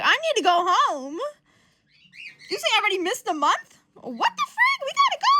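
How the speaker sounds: high, loud mock panic; hammed-up urgent freak-out; going nuts. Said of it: "He starts going nuts".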